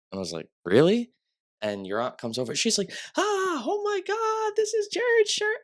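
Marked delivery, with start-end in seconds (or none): 0.6-1.1 high, loud rising question; surprised quote, jumping up in pitch; retold surprise. Retold surprise at meeting the uncle. 3.2-5.6 gushing, squeaky retold gasp; imitated thrilled aunt. Imitates the aunt's thrilled reaction.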